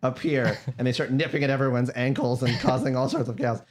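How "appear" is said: ordinary talk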